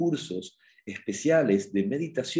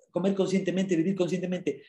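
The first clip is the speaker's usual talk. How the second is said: ordinary talk, nothing marked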